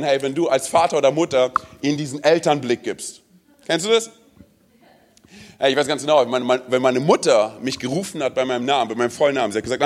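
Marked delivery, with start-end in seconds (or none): none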